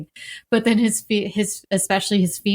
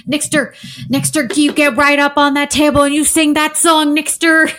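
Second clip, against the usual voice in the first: higher, louder eager urging; enthusiastic Lynch; 7 semitones higher, 8 dB louder than usual. Retold or imitated. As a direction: cheer him up onto the table. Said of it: "And he's like".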